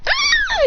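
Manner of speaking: very high-pitched shriek; mock scream. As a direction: let out a piercing comic scream. Scream in acted story.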